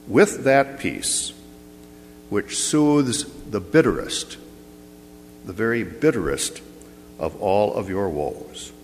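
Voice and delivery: ordinary delivery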